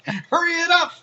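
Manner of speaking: high, loud, hammed-up complaint; mock angry customer. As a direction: play an impatient wrestler yelling at fast-food staff. Voices wrestler raging at McDonald's.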